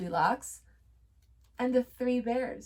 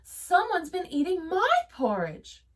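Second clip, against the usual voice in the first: cry; 9 semitones higher, 5 dB louder than usual. A narrator's reading. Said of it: "cried Mama Bear".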